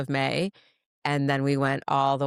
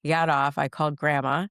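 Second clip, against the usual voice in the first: normal talking voice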